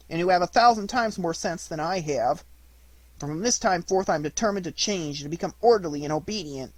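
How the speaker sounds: put-on a repentant wooden boy talking to himself on the run; remorseful puppet. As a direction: Pinocchio scolding himself while running home, high voiced. Pinocchio talks to himself.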